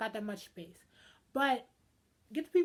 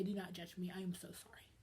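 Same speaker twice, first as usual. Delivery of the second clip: breathy whispered apology, much softer; 5 semitones lower, 10 dB quieter than usual. Real, not acted. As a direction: drop to a sudden whisper for the aside. Mock apology after joking aside.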